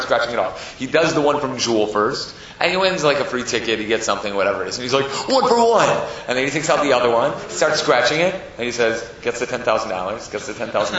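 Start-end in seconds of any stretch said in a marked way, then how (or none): 5.3-5.9 high, loud burst of excitement; excited cry. Retold or imitated. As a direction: crow over a small win. Winner's cry in acted story.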